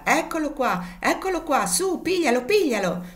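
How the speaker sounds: high, loud yelling to the others; excited high shout to chase the horse; shouting at full throat. A narrator's reading. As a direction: holler it out across the field. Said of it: "gridava allora a tutta gola".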